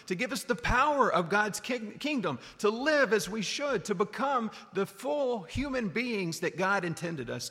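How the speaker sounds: rising, fervent preaching, pitched high. Acted. Preacher stirring up the congregation, fervent and high. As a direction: lift the congregation up with fervour.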